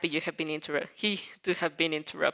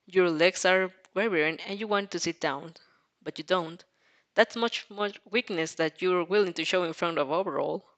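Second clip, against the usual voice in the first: plain reading voice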